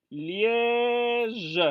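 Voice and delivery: "Liège" with a long, sustained è; exaggerated lengthened vowel. Shown as a demonstration. Teacher spelling out the city of Liège, very drawn-out "Liège". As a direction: hold the è of "Liège" on one note. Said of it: "c'est un peu plus long".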